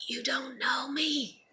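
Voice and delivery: whispered straight to critics, slow; hushed aside. Real, not acted. Whispering to people who judge her, slow and hushed. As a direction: drop to a whisper to shut them down.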